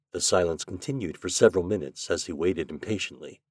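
usual speaking voice

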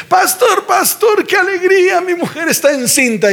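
joyful shout. Retold or imitated. Husband telling pastor his wife is pregnant, high joyful shout.

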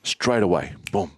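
usual speaking voice

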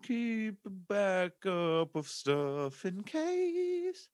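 slow high mocking echo; mocking slow repeat. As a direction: drag it out, high and teasing. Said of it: "mocking echo, slow high".

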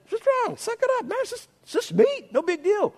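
high, mocking squeaky voice; a high mock voice brushing off a worried convert; mocking scoff. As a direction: scoff at the weak believer in a high put-on voice.

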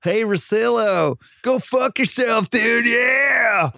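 hammed-up Texas accent with dude and yeah; bad Texas accent. Mock Texas accent taunting Russillo, shouted. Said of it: "talking like a person from Texas".

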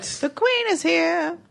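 high, slow spooky announcement; spooky announcer. Pretend. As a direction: draw it out like a spooky herald. Ominous announcement.

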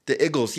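"Eagles" as "iggles", short i; Philly "Eagles". Shown as a demonstration. Repeating it for co-host, Philly "Eagles" as "iggles". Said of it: "they'd say Eagles".

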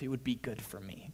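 a whispered inner thought. Retold or imitated. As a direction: breathe it like a secret hope. Inner voice tempted by church.

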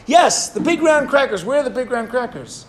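a rabbi's urgent outburst at his wife, searching for the crackers; urgent demand. Retold or imitated. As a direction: demand it, rising in alarm.

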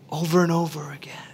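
hushed whisper of a haunting echo. Real, not acted. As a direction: breathe it out as a whisper. Voice replaying in head.

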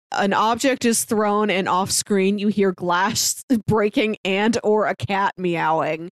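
usual speaking voice

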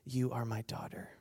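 soft, hushed assurance; tender reassurance. Acted. Jesus takes time with the woman, tender and hushed.

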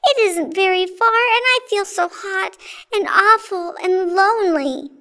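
put-on high-pitched, pleading little boy; crying plea. A narrator's reading. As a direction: beg them tearfully, high all through. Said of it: "cried James".

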